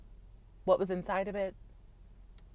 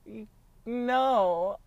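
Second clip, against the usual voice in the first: put-on exasperated girl no; 5 dB louder, much slower than usual. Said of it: "I was like".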